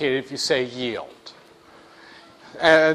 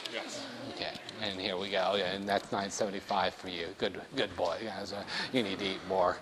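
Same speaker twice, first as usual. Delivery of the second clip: put-on lower, soft old-lady cashier voice; 8 semitones lower, 15 dB quieter than usual. Cashier Mabel greeting the buyer, low and soft. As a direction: croon like a kindly old cashier.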